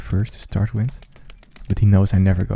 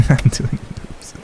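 whispering; 5 semitones higher, much slower than usual. Real, not acted. Whisper after a laugh.

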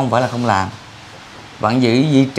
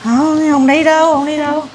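put-on whiny child refusing; 13 semitones higher than usual. Uncle mocking a reluctant child, high whiny kid voice. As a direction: mimic a kid who won't go. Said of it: "Còn như đứa trẻ khác là".

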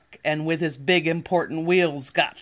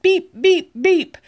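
horn beep; 10 semitones higher, 6 dB louder than usual. Acted. Echoing the truck's horn.

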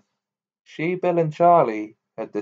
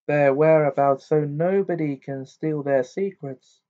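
higher voice, plainly informative; matter-of-fact; 4 semitones higher, 4 dB louder than usual. A narrator's reading. Girl explaining school rivalry to friends, matter-of-fact. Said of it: "said Hermione matter-of-factly".

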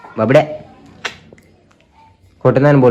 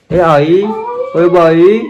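higher, louder, slowing into a sing-song mock lament; mock wailing; 6 semitones higher, 6 dB louder, much slower than usual. Teasing the crying child with a mock wail, higher and slower. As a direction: lament playfully like an old woman, drawn out.

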